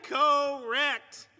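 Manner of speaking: long drawn-out buzzer verdict; game show host voice. Pretend. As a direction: stretch it like a quiz host. Host announcing a wrong guess.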